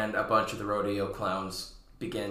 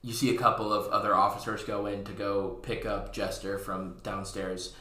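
normal talking voice

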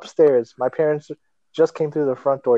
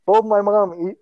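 ordinary talk

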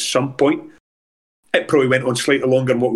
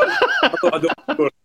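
laughing; 13 semitones higher, faster than usual. Real, not acted. Laughing at own forgotten goal.

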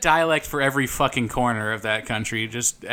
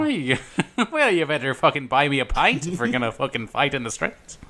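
laughing into mock Irish brogue; Irish accent. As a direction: challenge in a playful Irish accent. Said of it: "They're both pretty good".

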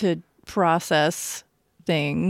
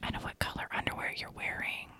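heavy breathing phone whisper; 18 dB quieter, faster than usual. Pretend. Creepy stalker whisper joke.

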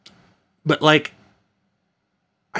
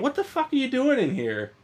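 startled; 12 semitones higher, 6 dB quieter than usual. Real, not acted. Startled by his wife entering.

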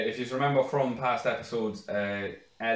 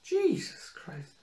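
high, slow amazed exclamation; drawn-out disbelief; 3 dB quieter, much slower than usual. Real, not acted. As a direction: stretch it out in disbelief. Reacting to brother named Stacey.

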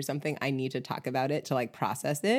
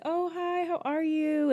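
much higher, singsong hello; cheery neighbor greeting; 13 semitones higher than usual. Retold or imitated. Calling hi to neighbors on their porch, high cheery greeting.

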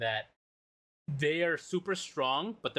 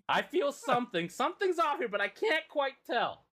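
puzzled high voice; 11 semitones higher than usual. Retold or imitated. Character senses something off.

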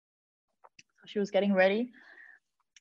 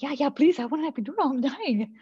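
desperate pleading; 5 semitones higher than usual. Retold or imitated. Begging for epidural.

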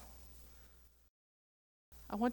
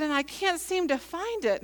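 higher-pitched, mildly exasperated retelling; retold frustrated plea; 8 semitones higher than usual. Lost preacher explains herself to an old man, frustrated. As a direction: act out being stuck and needing help.

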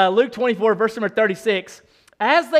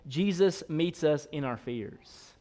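ordinary talk, nothing marked

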